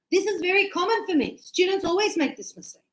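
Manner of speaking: teacher shrugging off a common student mistake, high and quick; amused teacher. Retold or imitated. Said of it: "And I was like".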